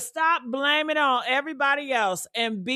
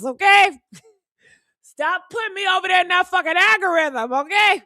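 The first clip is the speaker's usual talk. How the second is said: laughing shout; 6 semitones higher than usual. Real, not acted. Yelling at YouTube algorithm, laughing.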